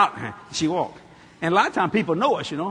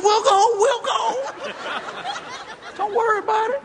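calm her down while laughing; laughing reassurance. Real, not acted. Soothing Kathy, laughing.

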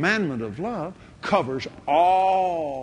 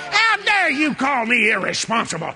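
indignant retort; 10 semitones higher than usual. Retold or imitated. Preacher answering the devil's accusation, outraged shouting. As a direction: fling the accusation back.

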